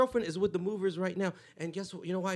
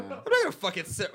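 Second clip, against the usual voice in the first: normal talking voice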